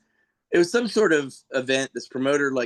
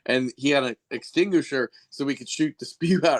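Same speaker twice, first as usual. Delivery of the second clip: usual speaking voice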